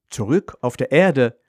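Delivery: ordinary delivery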